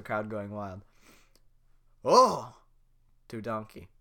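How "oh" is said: loud exclamation. Acted. Shrek grabbing Donkey.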